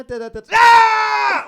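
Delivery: mumbling, then a sudden high loud shriek of pain; pain scream. Retold or imitated. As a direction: break off into a sharp yelp. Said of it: "suddenly I'd go".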